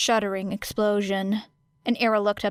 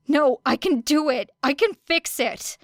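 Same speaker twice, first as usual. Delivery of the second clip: desperate insisting; 6 semitones higher, 3 dB louder than usual. A narrator's reading. Era struggling, lifted away.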